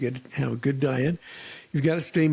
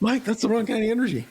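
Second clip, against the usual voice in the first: quick mock scolding; 9 semitones higher, faster than usual. Voicing friend scolding Mike.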